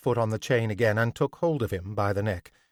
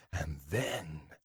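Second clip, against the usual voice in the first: much softer, lower confiding; voice sinking; 6 semitones lower, 13 dB quieter than usual. A narrator's reading. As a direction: drop the voice conspiratorially. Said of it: "his voice sank".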